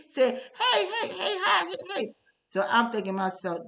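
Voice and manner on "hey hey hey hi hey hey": high, sugary mock greeting, much higher; phony sweetness. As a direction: imitate a fake-friendly church lady cooing. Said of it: "This is so phony".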